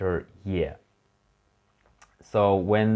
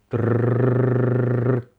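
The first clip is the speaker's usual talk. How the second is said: long loud rolled R trill; playful drawn-out trilled R; 4 semitones higher, 6 dB louder than usual. Shown as a demonstration. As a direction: roll the R hard and long. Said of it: "last one".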